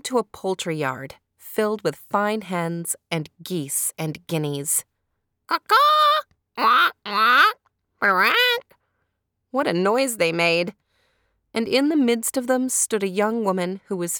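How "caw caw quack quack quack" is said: high squawking caw and quacks; bird calls. Acted. Narrator mimics the hens and geese, loud squawking. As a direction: squawk like a barnyard of birds. Poultry yard noise imitated.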